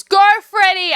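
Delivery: high, loud cheer; rooting for Freddy. Real, not acted. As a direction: shout of encouragement. Cheering a character.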